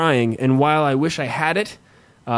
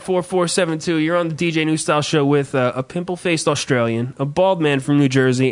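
ordinary delivery

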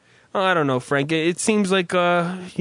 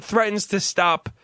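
normal talking voice

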